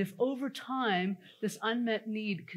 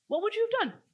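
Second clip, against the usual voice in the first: much higher, teasing question; playful challenge; 13 semitones higher, 3 dB louder, faster than usual. Real, not acted. Coach challenging the audience to act out the scene, high and playful. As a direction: throw it at them like a dare. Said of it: "play out that scene right now".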